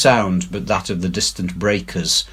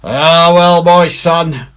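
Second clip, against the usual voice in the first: put-on louder, higher old pirate, slow at the end; Silver's pirate voice; 7 semitones higher, 9 dB louder, slower than usual. A narrator's reading. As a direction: scold the scared crew heartily. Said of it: "said Silver".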